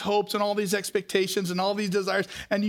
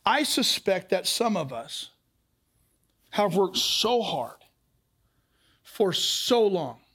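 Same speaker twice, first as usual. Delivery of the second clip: slow solemn; slower than usual. Real, not acted. Pastor gently confronting the tired congregation, slow. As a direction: let each phrase settle. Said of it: "slow solemn turn".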